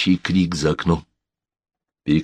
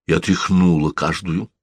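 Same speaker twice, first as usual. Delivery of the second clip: ordinary delivery, nothing marked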